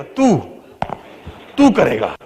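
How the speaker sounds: put-on doubt your own child with a pointed pause; imitating a dismissive parent; scornful disbelief. Parent mocking own child's ambition.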